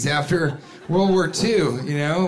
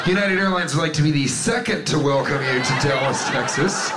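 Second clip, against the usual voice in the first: ordinary delivery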